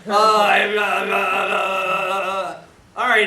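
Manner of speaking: loud, slow, stuck stammer; loud flustered rookie stammer. Retold or imitated. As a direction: stumble helplessly over every uh.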